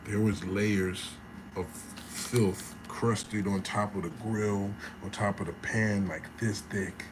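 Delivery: slow, low and soft; hushed disbelief. Real, not acted. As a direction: let the horror sink in, word by word. Appalled reveal of filthy kitchen.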